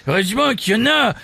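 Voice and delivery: high, laughing crude comic; Jean-Marie. Acted. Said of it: "un ami des grosses têtes, Jean-Marie".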